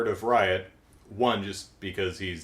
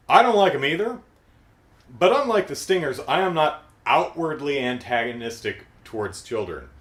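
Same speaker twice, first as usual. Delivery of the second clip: louder and higher, owning the confession; defiant admission, raised voice; emphatic aside; 4 semitones higher, 6 dB louder than usual. Real, not acted. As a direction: blurt it out with a grin. Speaker's own aside, emphatic.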